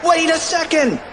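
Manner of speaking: high-pitched urgent call; excited cartoon yelp; urgent cry. A narrator's reading. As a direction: cry out high to halt a friend. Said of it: "cried Fluffy".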